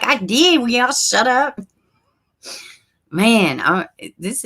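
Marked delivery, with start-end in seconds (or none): 0.0-1.6 exasperated retort. Retold or imitated. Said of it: "I'm like".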